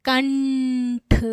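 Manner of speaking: कंठ drawn out very slowly, long n through the nose; nasal anusvar demo. Shown as a demonstration. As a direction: hold the nasal of कंठ before a separate ठ. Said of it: "अंग कहने पे यहाँ पे बल जा रहा है नाक पे".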